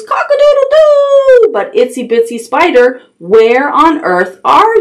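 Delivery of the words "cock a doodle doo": rooster crow. Acted. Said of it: "The red-feathered rooster crows".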